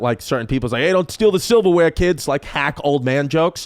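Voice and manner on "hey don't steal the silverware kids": higher-pitched, mild mock yell; mock dad yelling. Dad yells a hack joke at houseguests, mock yelling. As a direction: call it out like a joking dad. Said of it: "he would, like, be yelling at".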